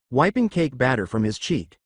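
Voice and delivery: plain reading voice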